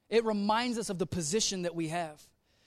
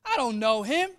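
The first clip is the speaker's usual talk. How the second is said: put-on high, offhand brush-off of the girl; casual Peter waving off a servant girl; mock dismissive Peter; 4 semitones higher, 5 dB louder than usual. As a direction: toss it off like she's nobody. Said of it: "Like he calls the little girl".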